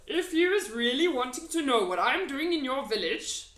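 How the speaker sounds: loud, folksy friendly giant. A narrator's reading. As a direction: play the big kind giant. BFG voice.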